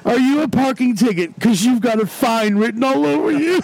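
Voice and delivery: high voice, strained by real laughter; crying with laughter. Real, not acted. Retrying the parking ticket pickup line, crying laughing. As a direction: get it out through tears of laughter. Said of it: "I'm fucking crying".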